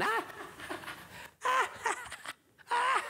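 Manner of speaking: put-on high staccato kids' laugh; mischievous cackle. Brats run away from the tree, high mocking laughter. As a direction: laugh in high, separate bursts. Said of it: "run away laughing".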